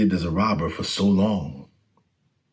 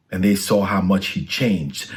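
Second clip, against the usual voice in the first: usual speaking voice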